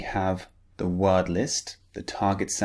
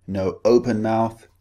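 ordinary delivery

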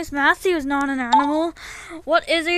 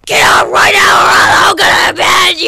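furious shout; 4 semitones higher, 12 dB louder than usual. Acted. Furious threats at the toys.

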